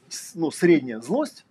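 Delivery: ordinary delivery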